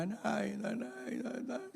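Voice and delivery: high, soft whimpering calls for mother; mock crying child. Child running to mother after finding no rice, mock crying. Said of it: "Umiyak siyang pumunta kay nanay".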